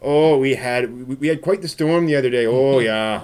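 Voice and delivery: put-on playful Fargo-style Midwestern accent. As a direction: chat like a folksy Upper Midwesterner. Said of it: "just be from the Midwest".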